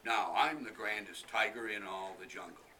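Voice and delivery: loud strutting tiger, nearer normal pitch; a lighter vain tiger boast. Acted. Tiger leaving with the umbrella, loud proud boast.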